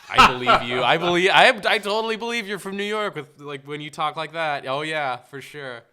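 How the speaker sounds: loud, high, over-the-top mock conviction; sarcastic belief. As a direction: pretend to be totally convinced, loudly. Sarcastically mocking actor's unconvincing accent.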